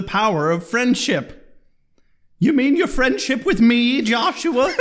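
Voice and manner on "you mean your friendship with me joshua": mom teasing her son, high. Pretend.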